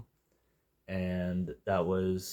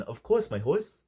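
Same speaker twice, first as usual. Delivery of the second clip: higher, rising, playfully hammed catchphrase; imitating a goofy catchphrase, full of pep; energetic imitation; 8 semitones higher, 4 dB louder than usual. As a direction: sell the silly rhyme with gusto. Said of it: "he said it with such, like, uh, energy".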